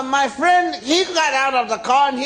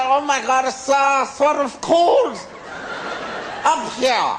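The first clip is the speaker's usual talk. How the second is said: put-on frozen-face German accent. Skier to nobody, frozen face: German-sounding. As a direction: talk like your face is frozen stiff and you sound German. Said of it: "I was talking like this".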